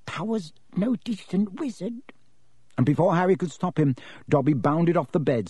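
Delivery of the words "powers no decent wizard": higher-pitched, urgent elf voice; high, hushed elf warning; urgent house-elf. Acted. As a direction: press the warning in a small creature's high voice. Dobby warns Harry in figure voice.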